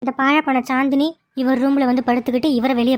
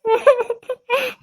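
coy giggle; 10 semitones higher than usual. Acted. Girl giggles at the man she loves.

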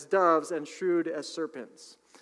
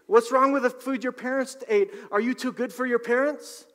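higher, louder mocking rant at a hippie; mock yelling heckler; 8 semitones higher, 6 dB louder than usual. Half the country yelling at the tomato buyer, mock angry. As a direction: sneer like an angry critic of organic food. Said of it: "half the country starts yelling at you saying".

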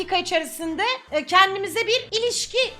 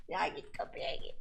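whisper; 11 semitones lower, 14 dB quieter than usual. Real, not acted. Urging the game character on.

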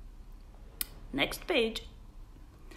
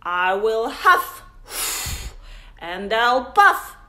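loud growled wolf threat, building louder; growling wolf; 9 dB louder than usual. Acted. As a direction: growl like the big bad wolf. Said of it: "growled the wolf".

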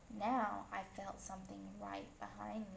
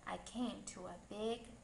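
usual speaking voice

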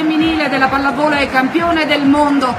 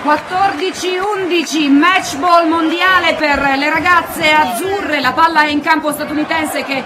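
excited victory call. Real, not acted. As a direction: shout the winning point with joy. Match point, Italy wins title.

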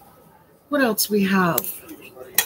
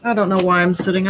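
ordinary talk

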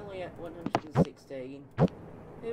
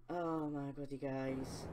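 normal talking voice

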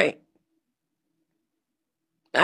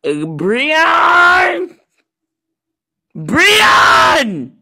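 high, loud shout of a name; calling out; 8 semitones higher, 7 dB louder than usual. Acted. Calling Breon loudly to come over. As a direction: call his name across the room.

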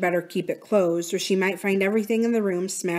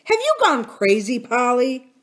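raised, louder exasperated question; demanding; 5 semitones higher, 5 dB louder than usual. A narrator's reading. Uncle Jocelyn demanding sense from his wife, exasperated. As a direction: challenge her in disbelief. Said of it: "he demanded".

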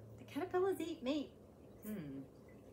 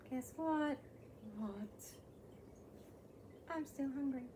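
normal talking voice

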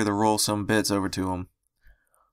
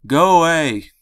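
loud raised-pitch yell; shouted; 7 semitones higher, 8 dB louder, slower than usual. Acted. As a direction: push them away with a yell. Sick pony shouting away friend.

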